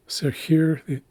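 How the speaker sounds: normal talking voice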